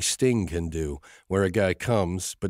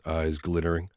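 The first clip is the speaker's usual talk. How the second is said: usual speaking voice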